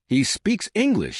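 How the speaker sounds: an understated narrated near-shout, pitched well up. A narrator's reading. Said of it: "she practically shouted".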